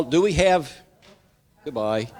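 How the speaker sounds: usual speaking voice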